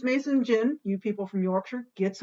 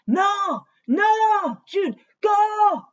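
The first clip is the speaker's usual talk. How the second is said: squeaky urging voice; 10 semitones higher, 7 dB louder, much slower than usual. Pretend. Urges himself on in another voice.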